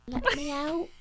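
high, loud mock call; trapped man's plea. Buried man begging to be released, high mock plea.

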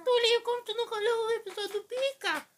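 put-on very high squeaky teenage rant; teen voice cracking. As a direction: rant like a teen whose voice is breaking. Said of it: "Aquela voz de adolescente".

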